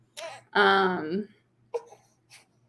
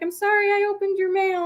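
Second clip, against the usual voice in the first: very high imitated old lady; old lady neighbor; 12 semitones higher than usual. Old neighbor at the door asks the vlogger, high imitated voice. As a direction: put on the elderly neighbor, pitched way up. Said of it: "she's an old lady with a cane".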